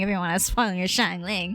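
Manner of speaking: ordinary talk, nothing marked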